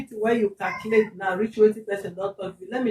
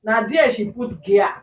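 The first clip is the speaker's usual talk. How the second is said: usual speaking voice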